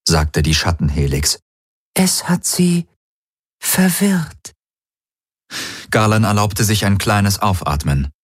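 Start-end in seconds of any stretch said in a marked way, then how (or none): 1.9-4.5 high, even otherworldly voice; alien helix voice. Acted. Alien helix reports its signal arrived, otherworldly voice.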